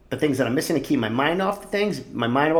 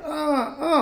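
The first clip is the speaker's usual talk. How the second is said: mock groans; 14 semitones higher than usual. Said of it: "I'm like".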